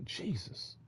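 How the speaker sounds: a whispered plea to Jesus; whispered prayer. Retold or imitated. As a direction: breathe his name in distress. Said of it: "and you just say".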